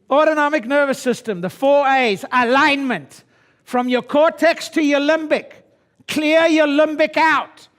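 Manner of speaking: high, loud revival-style exhortation; fired-up preaching. Acted. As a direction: rouse the room like a preacher. Preaching at full force.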